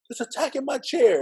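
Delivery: high, squeaky retold protest; indignant protest. Boy protesting to the teacher about the tack, indignant. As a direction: plead your innocence, outraged.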